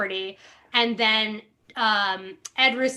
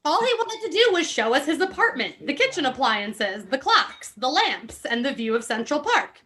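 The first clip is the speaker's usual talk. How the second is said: reading a memoir aloud with mock gravity; lightly hammed-up reading of a memoir; dramatic book reading; 4 semitones higher than usual. Host reads Ed Russo's book to friends, mock-dramatic reading.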